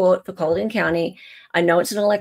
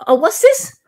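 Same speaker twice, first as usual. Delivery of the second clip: high, loud sudden surprise; surprised; 14 semitones higher, 6 dB louder than usual. Real, not acted. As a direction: jump up at the sight of it.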